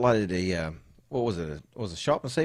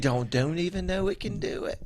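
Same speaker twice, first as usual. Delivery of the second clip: slow, higher mock British accent; mimicked British accent; 5 semitones higher, 3 dB quieter, slower than usual. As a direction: imitate his British accent, drawn out. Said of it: "You say".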